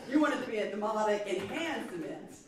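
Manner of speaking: ordinary talk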